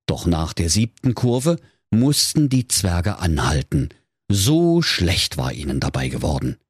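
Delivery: usual speaking voice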